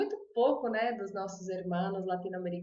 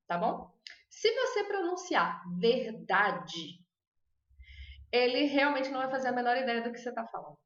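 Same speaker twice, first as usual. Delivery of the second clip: ordinary talk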